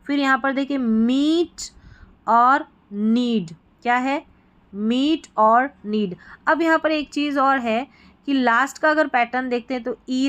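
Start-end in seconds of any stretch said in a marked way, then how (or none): 2.9-3.5 नीड with the ई held long and slow; long ee demo. Shown as a demonstration. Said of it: "long हो गई". 4.7-6.2 मीट and नीड with long, slow ई; long ee demo. Shown as a demonstration. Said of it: "long हो गई".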